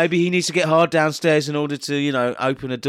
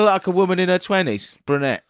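ordinary talk

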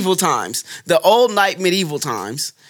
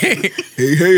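Yogi Bear voice. Retold or imitated. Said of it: "that sound like Yogi Bear".

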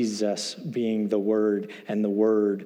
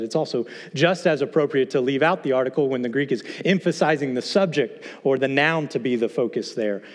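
ordinary talk, nothing marked

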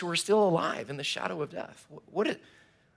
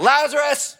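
put-on high, loud call to a hiding kid; Jesus calling Lazarus out of the tomb, loud and high; 11 semitones higher, 8 dB louder than usual. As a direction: call him out like a game of hide and seek. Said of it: "he just calls out".

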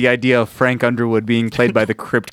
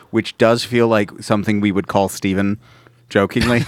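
ordinary talk

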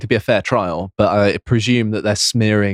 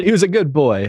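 mock grieving relative; 6 semitones higher than usual. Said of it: "you'll just get all of the people going".

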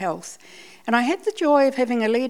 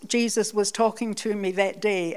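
ordinary talk

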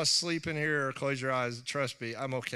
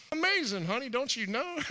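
high, mock sweet talk; mock sweet to wife; 8 semitones higher than usual. A husband sweet-talking his skeptical wife, mock. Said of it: "she ain't gonna believe".

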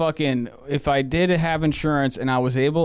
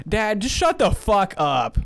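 slow, higher, fed-up comeback to dad; annoyed retort; 6 semitones higher, slower than usual. Retold or imitated. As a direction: drag it out like you're done with him. Retelling his snap at dad.

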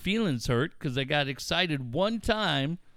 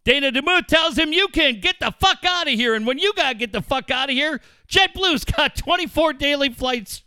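play-by-play into ad read; 5 semitones higher, 5 dB louder than usual. Pretend.

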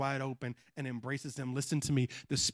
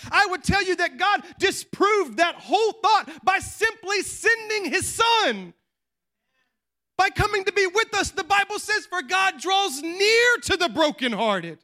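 high, loud, climbing sermon cry; preaching at full force; 13 semitones higher, 8 dB louder than usual. Acted. Pastor urging the congregation to stop faking, loud and high preaching. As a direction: preach it out to the whole room.

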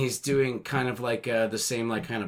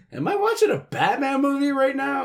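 baffled; 12 semitones higher than usual. Retold or imitated. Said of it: "you're like".

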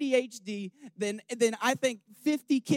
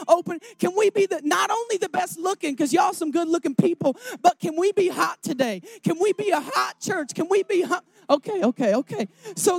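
louder and higher, driving each line home; full-force preaching; 6 semitones higher, 7 dB louder than usual. Acted. Pastor urges the church to be humble and open, full-force preaching. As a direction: preach it, building to a call.

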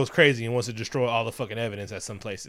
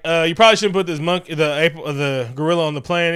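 louder and fast, mocking the officials; 4 semitones higher, 8 dB louder than usual. Retelling officials refusing the gorilla, loud and fast. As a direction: blurt it out quickly and loudly, like a dismissive official. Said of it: "they were like".

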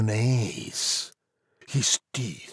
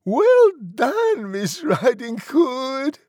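very high, loud piggy cheer; shrieked, jubilant praise; yelling pig; 5 dB louder than usual. A narrator's reading. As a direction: shout congratulations in a squealing pig voice. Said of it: "stood and yelled".

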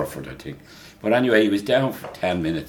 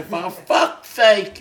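mock English accent; 12 semitones higher, 3 dB louder, much slower than usual. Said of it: "we heard him say".

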